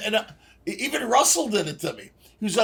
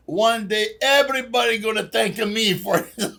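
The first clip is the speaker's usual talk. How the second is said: mock Italian chef voice, a bit higher and louder; a hammed-up Italian accent; fake Italian accent; 4 semitones higher, 4 dB louder than usual. Retelling a kid chef's ad line in fake Italian accent. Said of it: "I like when people do a fake Italian accent".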